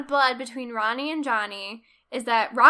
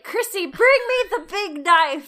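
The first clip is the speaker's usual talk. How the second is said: big operatic wail, higher and louder; hammed-up tragic cry; 6 semitones higher, 9 dB louder than usual. [name] hams up his agony before the bakery, operatic wail. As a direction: belt it out like a wounded opera hero. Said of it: "And then it's like".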